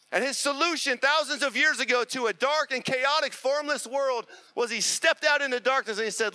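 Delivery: high, loud, urgent preaching over the band; preaching at full force. Acted. Pastor calling Christians out into the world, full-force preaching. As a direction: build it into a roaring call to the world.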